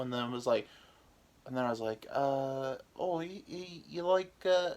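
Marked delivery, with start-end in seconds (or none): 2.1-4.7 high, halting attempt at English that slips Australian; botched English accent turning Australian. Joker retrying his English accent for the girls, drifting Australian. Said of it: "then I was Australian".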